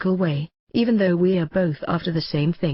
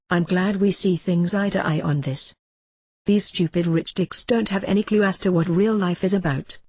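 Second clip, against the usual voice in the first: ordinary delivery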